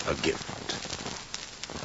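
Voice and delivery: barely voiced, low and hushed; furtive offer. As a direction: slip the bribe in under your breath. Bribe slipped to the mayor.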